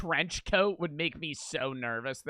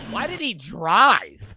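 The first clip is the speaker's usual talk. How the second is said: loud, slow, disbelieving question; baffled outcry; 3 semitones higher, 10 dB louder, much slower than usual. Real, not acted. Watching footage of a driver, a loud slow baffled question.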